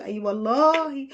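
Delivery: normal talking voice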